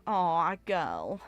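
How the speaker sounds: ordinary talk, nothing marked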